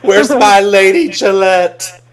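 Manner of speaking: high, loud, slow laughing call; laughing mock demand. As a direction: drag it out while laughing. Mock quote of sister.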